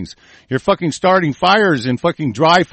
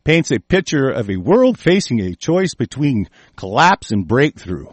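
ordinary talk, nothing marked

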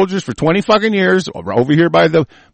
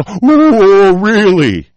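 mock surprise, higher and louder; sarcastic oh really; 4 semitones higher, 5 dB louder, much slower than usual. Real, not acted. Answering a news report about Congress with loud sarcasm. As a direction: feign amazement, dripping with sarcasm.

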